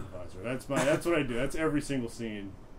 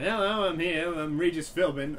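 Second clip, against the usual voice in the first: higher, louder breezy TV host greeting; Regis Philbin impression; 6 semitones higher, 6 dB louder than usual. Teen stand-up set, playing the quiz host. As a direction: ham up a cheery game show host. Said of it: "what it was, was I would be like".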